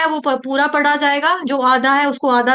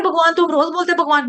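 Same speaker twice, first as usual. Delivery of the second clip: ordinary delivery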